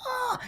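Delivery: high-pitched pretend shriek at normal volume; imitated girls' scream. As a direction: squeal like frightened girls in a retelling. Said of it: "and the girls scream".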